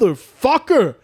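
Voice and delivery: high-pitched exasperated curse; frustrated curse. Retold or imitated. Annoyed at the hour-long queue, a high exasperated curse. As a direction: spit it out in disbelief at the wait.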